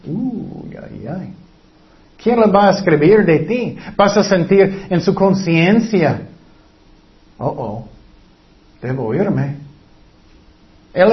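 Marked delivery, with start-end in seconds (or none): 0.1-1.3 soft worried "uy, ay, ay", dropping away; quiet uneasy moan; dismayed groan. Acted. Imagining Jesus writing your sins. 7.4-9.6 low, hushed "oh, oh" then slipping away; quiet embarrassed mutter; guilty unease. Acted. Guilty listener voiced.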